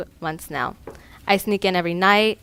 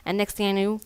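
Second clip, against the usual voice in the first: ordinary delivery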